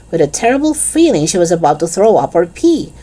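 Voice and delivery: ordinary delivery, nothing marked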